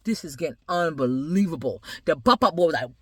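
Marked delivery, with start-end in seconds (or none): none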